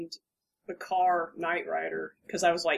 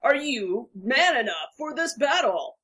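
high, hyped arcade announcer; game announcer; 5 semitones higher, 4 dB louder than usual. Retold or imitated. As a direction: build the hype, peak on the last words. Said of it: "That guy they have at Capcom who just patters on".

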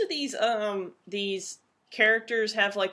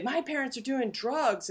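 higher, lightly voiced kid tattling; 5 semitones higher than usual. Kid telling on parents to police, lightly mimicked. Tattling kid's line retold.

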